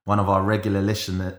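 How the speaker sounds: normal talking voice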